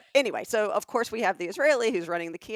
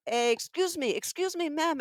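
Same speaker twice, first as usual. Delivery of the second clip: higher-pitched pestering salesman call; lightly mimicked seller hailing a customer; Israeli kiosk seller; 9 semitones higher than usual. Kiosk guy hailing a passing shopper, high mock pleading. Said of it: "the guy's like".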